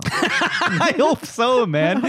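slow, laughing through the words. Real, not acted. [name] laughing at the stoned dad joke. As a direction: let the laugh carry it.

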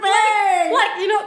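high laughing through words. Real, not acted. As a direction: laugh while talking. Laughing about hitting the mic.

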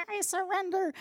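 high, strained imitation of choking out words; choked out. Woman retelling her surrender to God, choked out. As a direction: squeeze it out as if choking. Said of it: "But I choked out".

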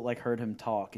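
ordinary delivery

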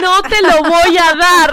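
slow, loud, high laughing no; teasing yell, won't give it; playful loud refusal. Real, not acted. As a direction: drag out the refusal loudly through laughter. Clutching microphone, refusing playfully.

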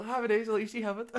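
giddy with the punchline, laughing it out; gleeful laughing retort. Retold or imitated. Retells triumph, laughing.

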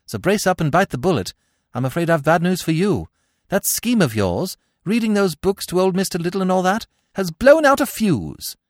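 high, chatty young gentleman breaking bad news; agitated upper-class chap.

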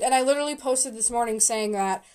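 ordinary talk

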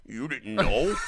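put-on character voice with a drawn-out end; Kenny. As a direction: say it in character, voice dropped a little. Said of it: "say it in the Kenny voice".

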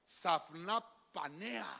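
Safnapanea drawn out syllable by syllable, low; slow spelled-out name. Shown as a demonstration. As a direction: say Safnapanea very slowly and carefully. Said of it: "se llama".

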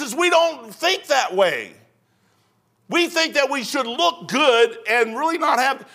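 full-force preaching to the congregation. Acted. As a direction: drive it home from the pulpit.

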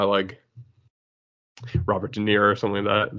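ordinary delivery

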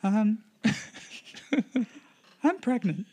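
high, put-on voice of a pregnant wife; girl voice. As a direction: confess in a high girlish pitch. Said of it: "You're gonna do the girl voice".

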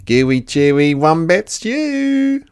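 high, loud, slow boastful chant; boasting. Acted. Dingo boasts to Emu about his stew. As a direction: show off your stew. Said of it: "boasted Dingo".

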